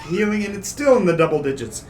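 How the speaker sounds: usual speaking voice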